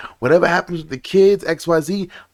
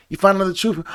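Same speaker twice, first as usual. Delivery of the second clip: ordinary talk, nothing marked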